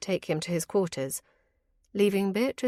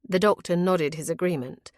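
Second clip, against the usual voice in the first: ordinary delivery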